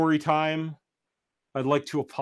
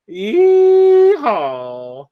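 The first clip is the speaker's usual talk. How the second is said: loud, high yeehaw; big cheerful yeehaw to close the story; cowboy whoop; 7 semitones higher, 11 dB louder than usual. Acted. Cheers at the book's end.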